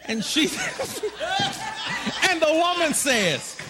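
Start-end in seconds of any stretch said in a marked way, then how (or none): 0.5-2.2 high giggle. Real, not acted. Laughing mid-story.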